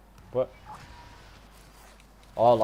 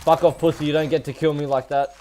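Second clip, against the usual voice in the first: loud, raised angry yell; angry shout; 4 semitones higher, 6 dB louder, faster than usual. Real, not acted. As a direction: snap it out loud at the enemy. Angry at being killed.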